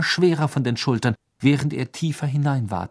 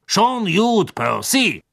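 high, loud, hearty interjection; jovial official; 7 semitones higher, 5 dB louder, much slower than usual. A narrator's reading. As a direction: wave the scolding away in a raised voice. Bagman jovially cuts Percy off.

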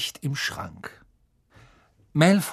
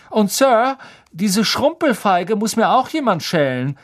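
high, loud, smug request; gloating Malfoy; 10 semitones higher, 6 dB louder than usual. A narrator's reading. Malfoy asks Snape for more help, gloating high voice. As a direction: push your luck, pitched well up. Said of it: "konnte ein gemeines Lachen kaum unterdrücken".

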